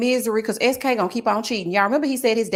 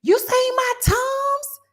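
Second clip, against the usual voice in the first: mock yell; much slower than usual. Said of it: "I'm yelling at my son".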